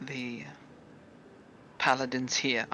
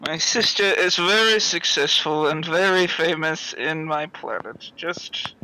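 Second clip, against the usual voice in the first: muffled, head down on the table; 2 semitones higher, 6 dB louder than usual. Acted. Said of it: "you just hear a very muffled voice".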